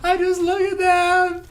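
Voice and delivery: high, loud, drawn-out mock wail; hammed-up bawling woman, words stretched out; mock sobbing. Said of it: "She's sobbing over".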